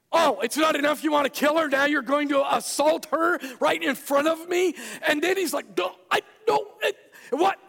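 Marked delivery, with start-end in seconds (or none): 0.1-4.7 loud, higher angry shout; hammed-up outraged king shouting; furious king. Furious king catches Haman. 5.8-7.6 very high, loud flustered stammer; comic caught-out squeal; panicked stammer. Retold or imitated.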